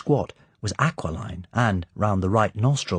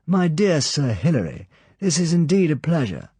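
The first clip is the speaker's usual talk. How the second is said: high, louder, animated villain's welcome; the Count's voice; 9 semitones higher, 5 dB louder than usual. Acted. Count welcomes Sir Hilary, light animated high voice. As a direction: greet the guest lightly, full of animation.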